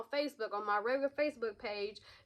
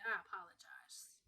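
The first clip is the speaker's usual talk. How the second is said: whispered; 5 semitones lower, 13 dB quieter than usual. Real, not acted. Quiet mock apology.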